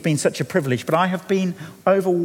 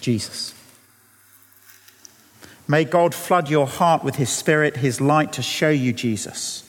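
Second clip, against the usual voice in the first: closing prayer. Acted. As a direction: pray gently over the people, letting it fall away. Closing prayer over congregation.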